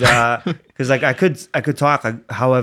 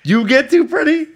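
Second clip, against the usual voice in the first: high, loud laughing disbelief; incredulous; 10 semitones higher, 6 dB louder than usual. Acted. Friend challenging co-host's claim of being pretty, loudly incredulous. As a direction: halt them with rising mock outrage. Mock-incredulous reaction to co-host.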